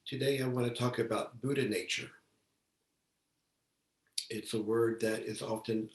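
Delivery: ordinary delivery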